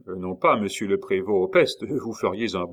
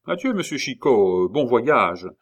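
loud, higher send-off; calling farewell; 4 semitones higher, 7 dB louder than usual. A narrator's reading. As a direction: call a cheery goodbye after a departing traveller. Officier lance un adieu à Chicot. (adieu lancé à voix haute)

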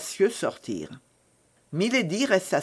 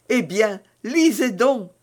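high, louder, triumphant urging; proud and joyful; 6 semitones higher, 5 dB louder than usual. A narrator's reading. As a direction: hand over the proof with pride and joy. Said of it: "dit dans l'excès de son orgueil et de sa joie".

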